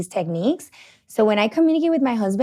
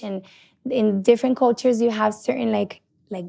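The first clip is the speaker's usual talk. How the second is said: ordinary talk, nothing marked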